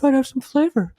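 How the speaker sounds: hammed-up imitation of a weird man; mock creepy man. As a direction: parrot him in a silly high voice. Imitating the man's gum remark.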